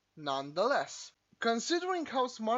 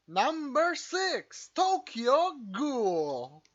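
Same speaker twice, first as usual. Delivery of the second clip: countdown announcer; 4 semitones higher, 5 dB louder, much slower than usual. Acted.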